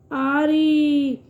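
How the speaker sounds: "آری" with a long stretched آ; long drawn آ in "آری", teacher demo. Shown as a demonstration. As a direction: hold the آ of "آری" slowly. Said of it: "اس کی آواز تھوڑی کھچ کے آتی ہے".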